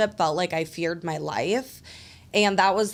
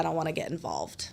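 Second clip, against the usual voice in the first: the driver's hushed brush-off; whispered refusal; 4 semitones lower, 8 dB quieter than usual. Retold or imitated. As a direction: whisper it, backing away from trouble. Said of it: "He looked at me and he said".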